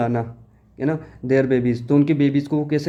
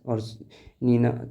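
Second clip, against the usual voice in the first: ordinary talk, nothing marked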